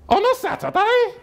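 very high-pitched mimicked woman; hammed-up high female voice; nosy woman neighbour. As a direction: squawk it like a prying neighbour. Woman neighbour quizzing him.

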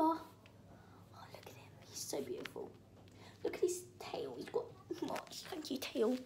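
soft breathy whisper, so as not to scare the cat; awed whisper over a beautiful cat. Real, not acted. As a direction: hush your voice near the cat. Whispering so cats stay.

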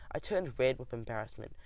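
usual speaking voice

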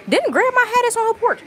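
high, loud burst of surprised recognition. Real, not acted. To the viewers, spotting grandma's old chair, surprised.

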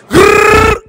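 high, loud rapper growl grrr; demo growl. Shown as a demonstration. Host demoing a rapper ad-lib, loud growl. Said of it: "let me hear you say".

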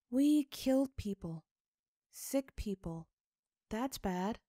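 put-on high, plain-spoken little girl; a child stating a hard truth simply; child Sophie's voice. A narrator's reading. Sophie explains to her father.

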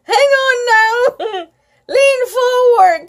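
high, loud call; shouted. Acted. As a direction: shout advice to the rider. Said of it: "Colin shouted".

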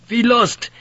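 very high, terrified outcry; panicked scream. A narrator's reading. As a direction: scream it out in despair. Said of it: "he screamed in Dutch".

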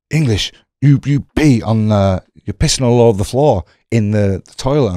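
hammed-up angry old boss, pointing the finger; accusing boss. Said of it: "this boss is going".

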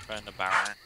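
ordinary talk, nothing marked

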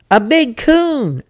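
high, loud, drawn-out boast; excited boy. Acted. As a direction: announce the big catch proudly. Boy proudly announces his catch.

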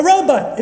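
robot high and falling, ending "but"; robot with a short "but" ending. Shown as a demonstration. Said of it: "If you say robot".

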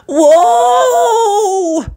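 very high cry; alarmed whoa. Acted. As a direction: yelp as you skid. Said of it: "Thomas called out".

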